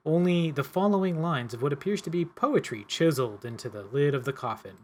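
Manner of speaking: normal talking voice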